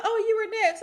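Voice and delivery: a sheepish apology, voiced high; high doctor voice. The doctor apologizes to the forgotten patient, imitated high. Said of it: "And she's like".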